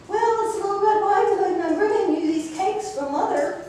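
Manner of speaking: put-on wolf faking a high, quick little girl voice; disguised voice. A narrator's reading. Wolf at Grandma's door, disguised as the girl, high and fast. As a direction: pitch up and hurry, playing the sweet granddaughter. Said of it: "he disguises his voice again".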